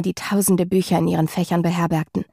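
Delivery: ordinary delivery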